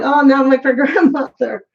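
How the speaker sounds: laughing through the words. Retold or imitated. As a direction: chuckle as you say it. Jokes about acting like grandmother.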